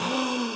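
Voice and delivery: sudden shocked intake of breath; retold shocked gasp; sharp gasp. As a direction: gasp in horror. Retold shock at seeing the floor.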